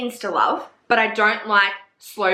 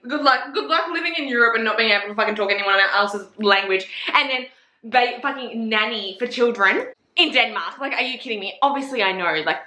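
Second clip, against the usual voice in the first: ordinary talk, nothing marked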